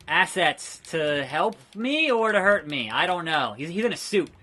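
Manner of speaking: normal talking voice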